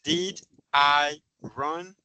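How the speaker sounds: "Did I run" word by word, slowly, rising on "run"; slow teacher's model of "Did I run" as a question; question intonation. Shown as a demonstration. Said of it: "sino en pregunta".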